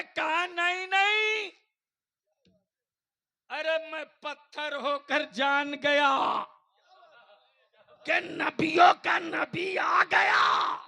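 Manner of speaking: high, slow shouted proclamation; full-force preaching. Real, not acted. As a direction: thunder it out phrase by phrase to the crowd. (गरजती हुई जोशीली तक़रीर)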